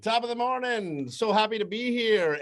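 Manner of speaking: lightly done mock Irish brogue, on purpose terrible; bad Irish accent. Host riffing on new signing in a bad Irish accent. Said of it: "the worst Irish accent you've ever heard".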